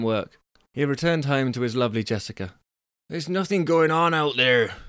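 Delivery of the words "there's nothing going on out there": Angus grumbling, pitched higher; complaining. A narrator's reading. Angus home to his lovely Jessica, complaining. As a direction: complain in a raised, put-on character voice. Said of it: "he complained".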